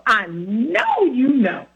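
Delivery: slow, deliberate I know you know; slow knowing sigh. Retold or imitated. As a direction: lay each word down slowly. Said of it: "and then she would wait".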